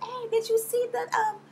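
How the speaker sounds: high, slow airy Portia voice; high mock ditzy question; imitating Portia. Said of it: "She was like".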